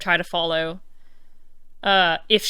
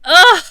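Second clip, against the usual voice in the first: loud frustrated ugh; 9 semitones higher, 14 dB louder than usual. Real, not acted. Frustrated groan at the game.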